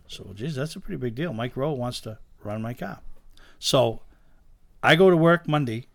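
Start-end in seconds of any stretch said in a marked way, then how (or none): none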